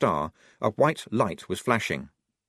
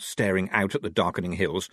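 plain reading voice

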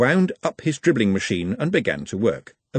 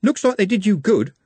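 brittle cheerfulness; 11 semitones higher, 5 dB louder than usual. A narrator's reading. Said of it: "with brittle cheerfulness".

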